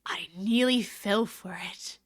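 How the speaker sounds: slow breathy whisper. Real, not acted. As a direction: whisper it to yourself, slowly. Caught by a name trick.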